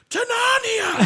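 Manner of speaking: very high, loud scream; screamed game quote. Retold or imitated. Friend screaming a game quote for no reason, shrieking. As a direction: shriek it out at full voice. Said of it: "just start screaming that out".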